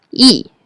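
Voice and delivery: "yi" falling sharply from high; fourth tone. Shown as a demonstration. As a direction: start "yi" high and drop it. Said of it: "will change into the fourth tone".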